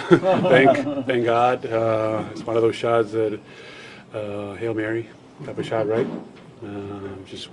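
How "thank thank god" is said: laughing out a loud thank God; loud relieved laugh on thank God; laughing relief. Real, not acted. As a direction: let the relief spill out in a laugh. Relieved laugh after lucky shot.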